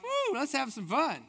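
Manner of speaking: high, loud party cheer; imitating a crowd cutting loose at a potluck; excited whoop. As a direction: whoop it up like folks ready to party. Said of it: "and they're like".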